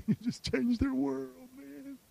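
high, slow, very soft wonder; hushed awe. Real, not acted. Preacher marveling at changing somebody's world, hushed awe. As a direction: let it out softly, trailing to almost nothing.

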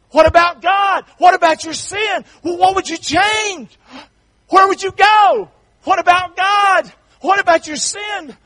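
shouted, high, rising preacher's call; full-force preaching. Acted. Preacher pressing the congregation about their last night, shouting. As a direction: hammer the questions home to the congregation.

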